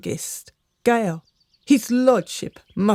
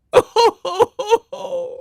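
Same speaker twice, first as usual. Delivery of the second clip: distraught sob-laugh; 13 semitones higher, 4 dB louder than usual. Acted. Servant finds lady seemingly dead.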